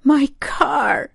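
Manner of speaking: high, tearful wail; high-pitched, acted crying; starting to cry. A narrator's reading. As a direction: wail it like a mother about to sob. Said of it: "she began to cry".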